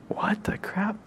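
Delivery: near-whispered baffled reaction; hushed disbelief. Retold or imitated. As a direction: say it under your breath, baffled. Retold baffled aside to companion.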